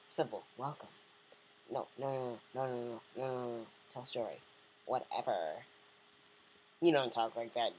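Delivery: ordinary delivery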